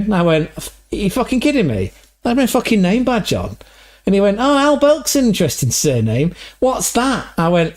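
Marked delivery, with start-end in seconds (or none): 0.6-3.6 higher-pitched mock indignation; retold exasperation. Retold exasperated reply to Jerry. 4.4-7.3 high, put-on Scottish-Irish accent; mock a Celtic man, voice pitched way up; Jerry's Celtic accent. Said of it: "the same guy, Jerry".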